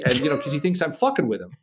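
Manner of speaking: ordinary talk, nothing marked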